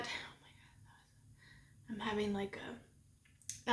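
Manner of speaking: breathy whispered oh my God. Real, not acted. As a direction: whisper it under your breath. Sugar rush aside.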